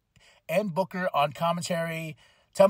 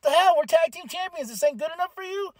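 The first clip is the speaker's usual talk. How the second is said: jealous Axiom; 10 semitones higher than usual. Retold or imitated. Wrestler whining at his tag partner, high and indignant. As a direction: squeak it out like a jealous girlfriend. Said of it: "Axiom's like".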